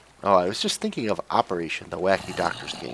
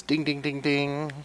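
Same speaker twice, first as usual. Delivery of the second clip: quoting Jarek; 5 semitones higher, slower than usual. Retold or imitated. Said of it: "Jarek says".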